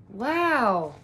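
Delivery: ordinary delivery, nothing marked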